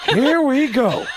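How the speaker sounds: lightly voiced weary impression of a famous actor; drawn-out, put-upon groan of a line; slow, resigned celebrity impression; Al Pacino. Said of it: "Pacino looked and went".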